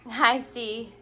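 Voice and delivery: ordinary talk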